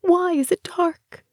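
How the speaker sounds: high-pitched, fearful asking; frightened question. A narrator's reading. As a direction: plead for an answer, pitched high. Tries to ask in terror.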